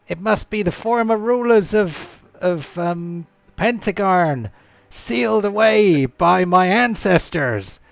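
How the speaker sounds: loud, high, hammed-up scream; Nate impression, screaming. Game master voicing Deep at the undead, screaming in high alarm. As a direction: cry out in alarm in a put-on accent, high and loud. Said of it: "Deep screams".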